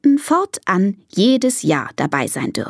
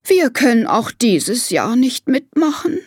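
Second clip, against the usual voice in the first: a touch of dejected elephant lady; resigned, lightly sighed; mildly sad; 5 semitones higher than usual. Acted. Elephant lady giving up on the contest, mildly sad. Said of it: "Traurig lässt sie".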